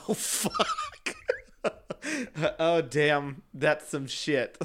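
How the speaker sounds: laughing imitation of stunned onlookers; mock dismay. Imitating people who see a grown man crying, mock dismay. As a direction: draw it out in amused alarm.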